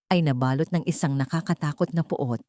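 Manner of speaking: normal talking voice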